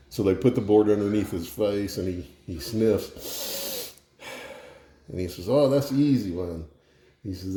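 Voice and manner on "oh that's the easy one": lightly Cajun, higher pitch; Justin Wilson. Acted. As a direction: toss it off with a touch of Cajun. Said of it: "do the Justin Wilson thing and tell a joke".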